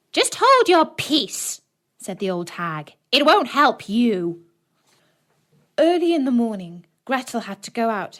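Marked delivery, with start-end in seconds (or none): 0.1-1.6 high, slow witch snapping; scolding witch. A narrator's reading. Witch snaps at Gretel. 3.1-4.4 mocking witch. A narrator's reading. Witch mocks Gretel.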